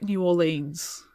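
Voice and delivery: "New Orleans" said slowly, as "nyoo or-leenz"; British pronunciation. Shown as a demonstration. A Brit shows how she says "New Orleans", slowly and carefully. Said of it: "as a Brit".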